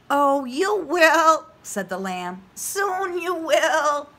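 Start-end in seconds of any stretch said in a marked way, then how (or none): 0.1-1.5 high, slow lamb voice. Acted. Lamb reassures. 2.5-4.1 high, slow lamb voice. Acted. Lamb reassures.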